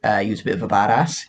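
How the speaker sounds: ordinary delivery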